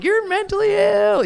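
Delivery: high, squeaky mock friend. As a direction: parody friends teasing her. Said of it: "being like".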